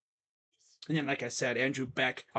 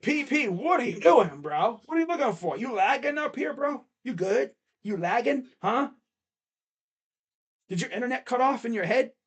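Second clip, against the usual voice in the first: mock-concerned ribbing, higher; teasing; 6 semitones higher than usual. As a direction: needle him like a buddy roasting him. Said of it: "I'd be like".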